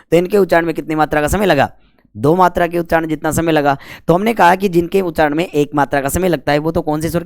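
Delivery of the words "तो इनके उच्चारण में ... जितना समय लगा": ordinary talk, nothing marked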